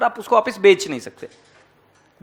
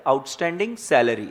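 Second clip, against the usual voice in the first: soft, lower, delicate naming of the term; tenderly; 7 semitones lower, 8 dB quieter than usual. Shown as a demonstration. Teacher to students, saying the term with loving delicacy. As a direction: say it lovingly, as if it were precious. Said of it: "प्यार से नाम बोलेंगे, नजाकत से".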